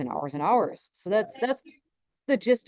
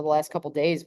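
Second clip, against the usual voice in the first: normal talking voice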